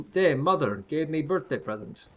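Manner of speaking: lightly put-on Eastern European accent; a playful, hammed-up Eastern European accent. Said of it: "racist East German, Eastern European accents".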